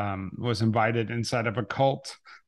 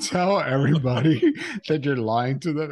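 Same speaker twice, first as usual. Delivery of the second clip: laughing; 3 semitones higher, 3 dB louder than usual. Retold or imitated. Quoting the deadpan question, laughing.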